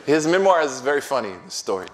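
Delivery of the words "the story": ordinary talk, nothing marked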